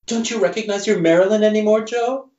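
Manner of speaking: high, fast, seductive starlet purr. Acted. Creature coaxes frightened Joe to recognize it, high purr. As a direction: purr it to lure him in. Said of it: "it purrs".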